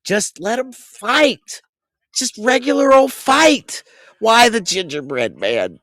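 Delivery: high, slow, drawn-out plea; over-the-top pleading. Real, not acted. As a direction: beg the bookers like a heartbroken fan. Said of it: "acting a little bit over the top".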